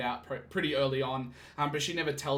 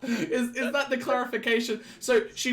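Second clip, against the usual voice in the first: still laughing; 7 semitones higher, 3 dB louder than usual. Real, not acted. Laughing at co-host's joke.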